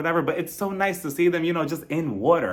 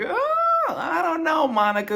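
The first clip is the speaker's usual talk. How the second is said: suspicious doubt; slower than usual. Retold or imitated. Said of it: "looking at her like".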